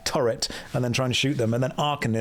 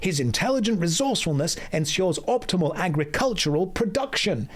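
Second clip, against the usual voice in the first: higher, proud storytelling shopkeeper; higher, hammed-up NPC merchant voice; Kadikarus impression; 5 semitones higher than usual. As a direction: boast about the city like a cheerful merchant. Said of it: "be doing a Kadikarus impression".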